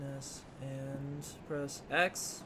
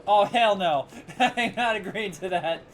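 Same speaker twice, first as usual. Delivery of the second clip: louder, laughing "hell no"; laughing loud refusal; 4 semitones higher, 9 dB louder than usual. Real, not acted. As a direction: refuse loudly through laughter.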